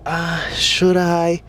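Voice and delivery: mocking a guy stuck hesitating; mock hesitant moaning. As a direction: moan it out, stalling and unsure. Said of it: "you're over here moaning in the corner".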